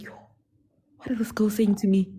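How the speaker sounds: shocked whisper to herself; stunned whispered aside. Retold or imitated. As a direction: breathe it out in disbelief. Inner reaction to shocking confession.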